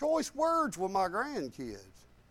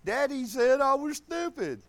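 put-on higher, whiny child's complaint; hurt little boy; 6 semitones higher than usual. Son runs to Mommy, whining like a hurt little boy. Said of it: "he runs to Mommy".

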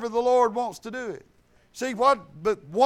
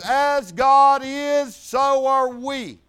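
very slow and louder, each word weighed; slow proclamation; 3 semitones higher, 7 dB louder, much slower than usual. Acted. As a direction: proclaim it slowly like scripture from the pulpit. Preacher proclaims scripture slowly.

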